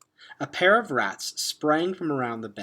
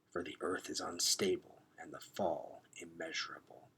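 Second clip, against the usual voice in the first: low unvoiced rat whisper; 5 semitones lower, 10 dB quieter than usual. Rip Red warning the questers before open space, whispered. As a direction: breathe the warning like a lethal rat. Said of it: "spoke in a whisper".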